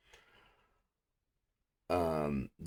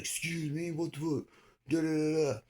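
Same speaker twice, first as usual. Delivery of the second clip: high garbled mimicry trailing into babble; mock the cop's indignant jabber; mocking officer; 12 semitones higher than usual. Cop answering handcuffed suspect, high mocking imitation.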